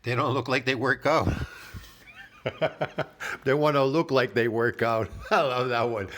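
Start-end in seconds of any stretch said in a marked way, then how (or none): 2.4-6.1 real laughter around the words; amused laughing. Real, not acted. Reactor relishing the line about working out, laughing. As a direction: repeat it while cracking up.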